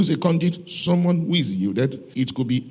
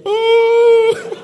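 high, loud hammed-up cry of Oh; mock prophetic shout; 10 dB louder than usual. Mocking how some women start prophesying, loud mock cry. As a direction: belt a hammed-up Oh like a woman prophesying. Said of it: "want to copy a woman".

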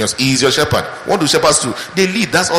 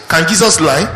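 ordinary talk

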